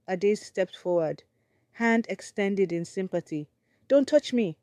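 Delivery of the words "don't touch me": high, loud mock scream. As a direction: recoil and scream at her. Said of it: "Neoma screamed".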